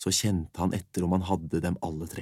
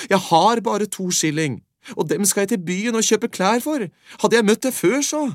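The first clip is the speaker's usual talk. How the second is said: put-on much higher, louder boy voice; boy telling the beggar he has two shillings, high and loud; 13 semitones higher, 8 dB louder than usual. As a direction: complain like a lad losing his last coins. Boy lamenting his coins.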